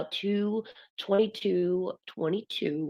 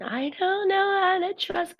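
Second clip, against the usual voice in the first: high, whiny worried voice; mock helpless whine; mock doubter voice; 11 semitones higher than usual. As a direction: play the unsure student, pitched up high. Voicing a doubtful listener.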